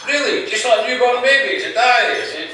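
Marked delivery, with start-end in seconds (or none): 0.1-1.6 high-pitched disbelieving question; incredulous echo. Acted. Old man doubts Slim's claim, high incredulous echo. Friend echoes Slim in disbelief. 1.8-2.2 put-on high, assured old man's yes; chipper old man. Slim confirms to his friend, high and chipper.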